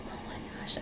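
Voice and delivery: soft amazed whisper. Real, not acted. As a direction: breathe it out in disbelief. Amazed at first day ending.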